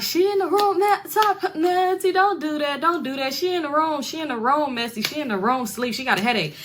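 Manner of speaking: high, panicky stalling voice; a man caught out, talking fast to cover; flustered Dolla. Retold or imitated.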